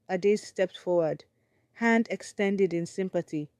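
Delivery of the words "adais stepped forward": plain reading voice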